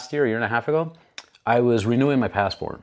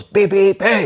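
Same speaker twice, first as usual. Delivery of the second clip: mock horn call; 9 semitones higher, 5 dB louder, slower than usual. Imitating car horn, driver calling.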